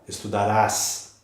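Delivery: "estudarás" slow, with the final RÁS stressed. Shown as a demonstration. Teacher showing stress outside the root, RÁS stressed in "estudarás". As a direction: draw out the last syllable of "estudarás". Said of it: "A sílaba tônica está lá".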